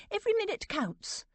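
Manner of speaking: very high, urgent protest; worked-up, high-pitched insistence; agitated protest. A narrator's reading. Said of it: "Aunt Dotty was protesting".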